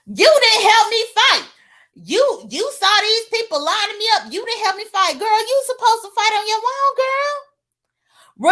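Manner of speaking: high-pitched, mildly hammed-up accusation; mock complaint. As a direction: imitate someone protesting at being left to fight alone. Mimics her quarrelsome complaint about fight.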